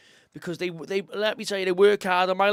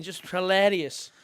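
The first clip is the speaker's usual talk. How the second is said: usual speaking voice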